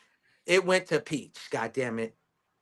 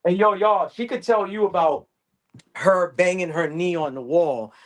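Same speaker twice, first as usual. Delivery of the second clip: ordinary talk, nothing marked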